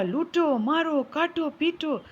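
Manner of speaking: high, repetitive chanted commands; incessant parrot cries. A narrator's reading. Parrot chasing the king with the same cry, high and insistent. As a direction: keep crying out, word after word. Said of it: "लगातार यह बोलता रहा".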